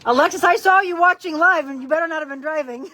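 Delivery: loud, high teasing scold; teasing call-out. Real, not acted. Trainer loudly teasing client for watching live, high and loud. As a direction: call across the room and tease her.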